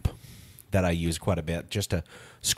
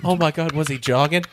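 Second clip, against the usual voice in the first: louder, high-pitched mock surprise; teasing disbelief, up high; mock amazed; 8 semitones higher, 6 dB louder than usual. Real, not acted. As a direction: play it up as a gasp of discovery.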